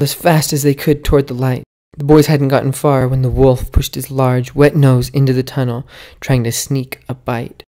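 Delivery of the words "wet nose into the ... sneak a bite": ordinary delivery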